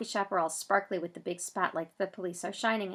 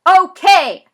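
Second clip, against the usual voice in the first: big high yell, much louder; 11 semitones higher, 21 dB louder than usual. Acted. Zach yelling back to the police. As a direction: shout it across the parking lot. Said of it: "I yell back".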